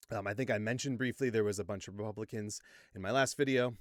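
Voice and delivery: ordinary delivery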